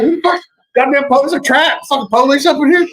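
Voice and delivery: high-pitched, hammed-up jumpy outburst; mock alarm. Host jokes about police at the door, mock alarm. Acts out jumpy reaction to knock.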